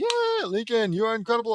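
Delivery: lightly acted fan cheer. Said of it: "We all cheer wildly".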